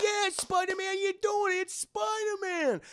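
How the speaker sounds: squealing mock fan shout; cheering crowd. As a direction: whoop like an onlooker rooting for a hero. Crowd cheers on Spider-Man.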